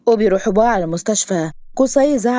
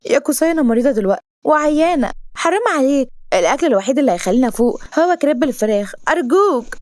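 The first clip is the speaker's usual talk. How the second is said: high, wheedling heroine; pitched-up coaxing plea; pleading; 6 semitones higher than usual. Acted. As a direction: beg him as a pitiful patient. Heroine begs for crepe.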